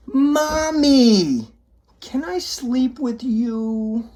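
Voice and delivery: put-on high, whiny little child; toddler pleading. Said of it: "one small toddler whisper".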